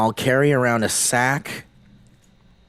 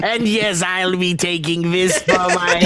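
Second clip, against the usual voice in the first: goblin voice; 2 semitones higher than usual. Retold or imitated. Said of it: "in my goblin voice".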